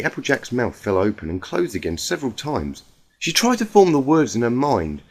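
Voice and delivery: plain reading voice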